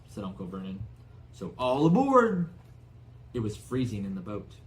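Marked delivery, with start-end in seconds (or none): 1.3-2.5 loud hearty all-aboard; loud cheery summons; loud call. Acted. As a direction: call them into the boat. Uncle Vernon calling out.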